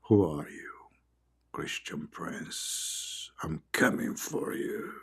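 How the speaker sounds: slow, breathy lizard whispering low; hissing lizard, whispered low and slow; creepy lizard whisper. Acted. Lizard voiced in his story.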